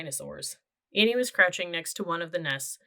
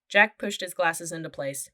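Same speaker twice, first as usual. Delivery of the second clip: plain reading voice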